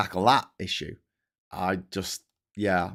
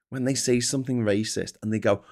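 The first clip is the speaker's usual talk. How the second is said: normal talking voice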